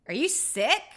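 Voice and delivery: high, loud questioning rise; mock-alarmed question; imitated startled coworker. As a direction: ask it loud and high, as if taken aback. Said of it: "and he goes".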